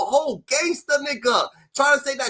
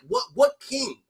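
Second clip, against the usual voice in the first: ordinary delivery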